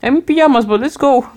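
a touch of happy eagerness, ready to go; spontaneous yes. Retold or imitated. Friend in pajamas agreeing to go out, mild eager lift. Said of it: "someone else. Like".

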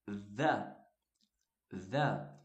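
"The" said low and clear, as an English model; article the said. Shown as a demonstration. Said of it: "você usa T H E".

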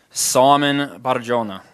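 put-on slow, lilting Italian-accented name. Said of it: "I put an Italian accent on it".

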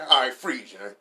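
loud cop yelling freeze; mock cop shout. A cop yelling at R. Kelly to freeze. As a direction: bark it like a cop making an arrest.

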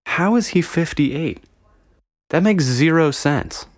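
high, incredulous question; baffled disbelief. Real, not acted. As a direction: ask it like it can't be true. Baffled by actor's age.

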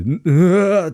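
surprised cry. Retold or imitated. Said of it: "ってなって".

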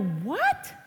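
mock disbelief, swooping up at the end; retold startled what. Mom reacting to what Maeve tells her, mock disbelief. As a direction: act out a parent's incredulous question. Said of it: "and I go".

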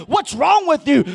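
loud scornful question; mock scolding, loud; mocking accusation. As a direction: throw the accusation at him. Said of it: "they're like".

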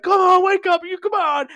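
high, loud, urgent begging; imitated desperate plea. Freddy over dead Billy as retold, mock desperate shouting. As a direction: cry out as if rousing a lifeless friend.

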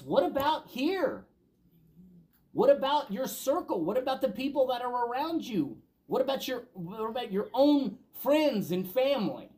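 rising preacher's challenge; preaching. Acted. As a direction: build the questions like a preacher calling out. Preacher rising to emphatic questions.

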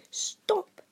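high, loud command. A narrator's reading. Uncle Vernon suddenly finding his voice, commanding. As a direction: cut in sharply. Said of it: "he commanded".

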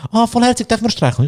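high, cooing mock women's voice; gushing women. Women at the station asking to pet, in a high coo. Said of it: "so eine Gruppe, so Frauen".

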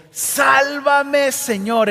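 loud, high cry for help; shouted plea. Acted. Peter sinking in the waves, shouting to Jesus. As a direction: yell it as if going under. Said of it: "y gritó".

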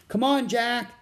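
high, loud call from outside. A narrator's reading. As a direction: call a friend to hurry along. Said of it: "Annie called outside the hut".

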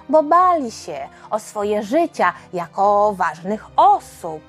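plain reading voice

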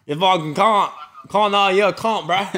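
high, loud Aussie ribbing; hammed-up, affectionate Aussie insult; mock Australian. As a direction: echo it in a broad fake Australian accent. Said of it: "we were just talking Australian".

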